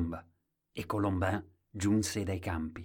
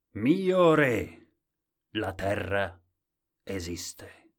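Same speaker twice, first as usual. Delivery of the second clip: clear and loud; 7 dB louder, much slower than usual. A narrator's reading. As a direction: proclaim it in solemn separate phrases. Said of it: "disse chiaro e forte".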